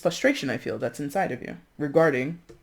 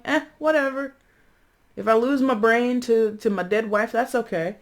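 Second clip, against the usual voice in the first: higher, careless shrug of a voice; apathetic shrug; 7 semitones higher than usual. Pretend. Man in the undead wife's lap, careless. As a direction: toss it off like nothing matters. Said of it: "he's kinda like".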